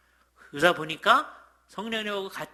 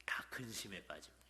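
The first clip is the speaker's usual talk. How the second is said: whispered worry; 7 semitones lower, 15 dB quieter than usual. Acted. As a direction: drop to a whisper, lower. Mock worry at a veteran believer. (걱정스럽게 속삭이며)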